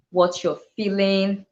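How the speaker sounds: normal talking voice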